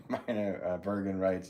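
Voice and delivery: ordinary delivery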